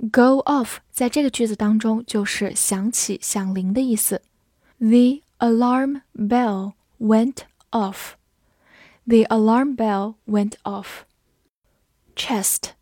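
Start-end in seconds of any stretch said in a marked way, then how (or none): 4.8-8.1 word by word, very slow, with gaps; slow read-along. Shown as a demonstration. Teacher modelling the alarm bell sentence, slowly. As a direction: stretch it out for learners to follow.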